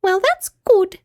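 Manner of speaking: very high little mouse voice; Teeny. A narrator's reading. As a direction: chirp it as a tiny mouse. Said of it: "said Teeny".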